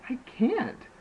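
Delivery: high protesting whine. Acted. Snake protesting to his brother, high and whiny. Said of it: "Snake protests".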